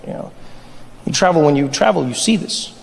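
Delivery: ordinary talk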